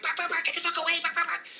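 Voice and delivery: high, far yappy little-dog voice; squeaky mock yapping dog; scared little dog. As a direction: yap it out like a terrified tiny dog. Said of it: "all these little dogs who are like".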